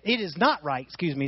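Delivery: ordinary talk, nothing marked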